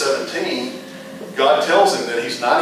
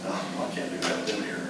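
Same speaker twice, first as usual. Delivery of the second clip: much softer, breathy aside to himself; hushed inner thought; 2 semitones lower, 12 dB quieter than usual. Retold or imitated. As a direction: think it under your breath. Inner thought, parents present.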